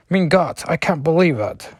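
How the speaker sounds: weak German accent attempt for Nightcrawler; a clumsy try at a German accent; bad German accent. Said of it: "my German impression is horrible".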